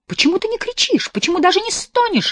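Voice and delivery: put-on very high taunting girl's voice. A narrator's reading. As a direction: goad him cruelly. Girl voiced in story. (очень высокий дразнящий голос)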